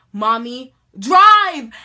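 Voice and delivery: panicked yell to drive; urgent shout. Retold or imitated. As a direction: order mom to go now. Urgent demand in car.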